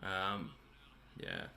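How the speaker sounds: ordinary talk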